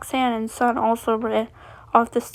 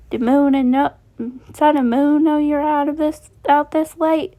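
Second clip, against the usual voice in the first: raised, lightly put-on Southern accent; 4 semitones higher, 4 dB louder than usual. A narrator's reading. Monty checking on lost Chi-Chi, Southern accent. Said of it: "in his thick Southern accent".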